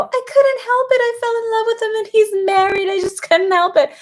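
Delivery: high mocking impression of a woman who fell for a married man; mock quote. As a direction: squeak it out like someone who swears they had no choice. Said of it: "when they go".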